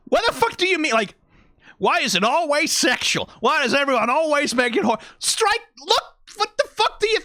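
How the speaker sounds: high-pitched, sputtering mock indignation; comically flustered villain losing patience; exasperated villain. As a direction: rant in baffled frustration, breaking off mid-phrase. Voicing Stryker's exasperation at others.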